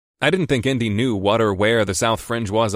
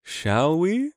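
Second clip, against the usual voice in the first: lightly imitating the lofty crab; a playful hint of crab loftiness; lofty crab imitation; 7 semitones higher than usual. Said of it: "a quiet imitation of the crab's lofty tone".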